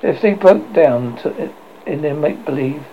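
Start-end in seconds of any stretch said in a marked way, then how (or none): none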